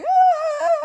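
very high, loud "ah"; high-pitched mimicked yell; imitated brother's cry. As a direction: imitate a sudden high squeal. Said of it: "your brother just going".

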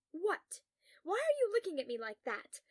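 loud, high, affronted questions; defensive. A narrator's reading. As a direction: challenge the listener's look. Defensive with a colleague.

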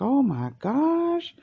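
high, slow, stunned oh my gosh; high stretched-out horror at the kitten; retold gasp of shock.

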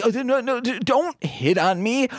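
high, stammering mock protest; flustered timid teacher.

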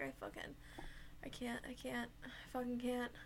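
desperate whisper. Retold or imitated. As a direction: whisper it, at the end of your rope. Breaking down by freezer.

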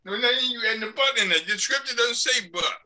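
firmly object, playing someone sure of himself; adamant. Acting a stubborn street convert to the congregation, adamant. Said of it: "he was very adamant that he was saved".

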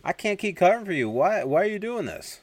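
high-pitched, pleading reprimand; acted-out fed-up officer; exasperated scolding. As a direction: scold him at the end of your patience. Officer scolds Mahoney in questioning.

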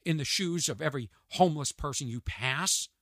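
ordinary delivery

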